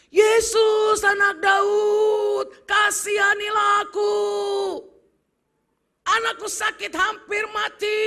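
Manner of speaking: high, loud, drawn-out cry for mercy; desperate shout. Acted. Mother crying out to Jesus for her dying child. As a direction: call out over the crowd, begging. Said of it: "dia mulai berteriak".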